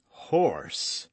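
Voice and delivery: low, understated distaste; disgusted. A narrator's reading. Aramis learning he is eating horse, disgusted. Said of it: "with a grimace of disgust".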